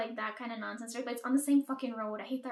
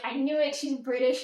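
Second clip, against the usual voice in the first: excited realization; 7 dB louder than usual. Retold or imitated. Realizing the roommate is British.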